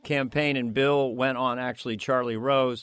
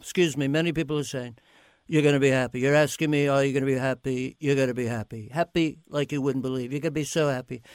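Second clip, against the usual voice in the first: lightly voiced Trump rambling; Trump impression; faster than usual. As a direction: drift in loops like Trump. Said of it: "repeating words, odd, bizarre".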